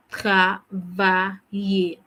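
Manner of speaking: Travailler said very slowly, syllable by syllable; slow model word. Shown as a demonstration. Teacher leading repeat-after-me, very slowly. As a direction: say it slowly so they copy. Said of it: "Repeat after me".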